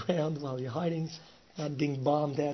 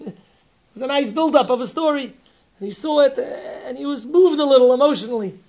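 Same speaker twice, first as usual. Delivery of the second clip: louder, higher, swept up; higher, louder, laughing into the story; excited retelling; 10 semitones higher, 12 dB louder than usual. Real, not acted. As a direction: build with rising excitement. Teller gets excited recounting.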